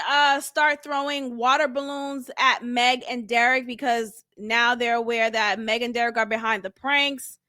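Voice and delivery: ordinary talk, nothing marked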